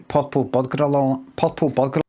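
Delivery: "purple burglar" mashed into "potpol boglar"; "purple burglar" with the r sounds swallowed; tongue-twister mispronunciation. Shown as a demonstration. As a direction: stumble through "purple burglar" with short o vowels. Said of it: "some Scottish people that kinda say".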